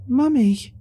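put-on a small girl spotting her mother; happy little girl. A narrator's reading. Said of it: "she said happily".

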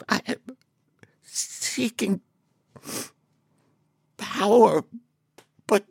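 high, halting, breathy awe; awestruck shaky creature voice. Acted. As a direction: stammer it out in dazed wonder. Awestruck Hell Grammet in character.

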